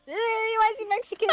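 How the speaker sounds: loud high disbelief; surprised. Real, not acted. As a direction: shout it in shock. Shocked at the clip's voice.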